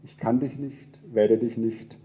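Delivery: solemn poem reading with swelling refrains; poem recitation. Acted. Pastor reciting a poem as God to the congregation, solemn and swelling. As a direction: recite it as God speaking, rising and falling.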